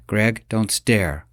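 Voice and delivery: usual speaking voice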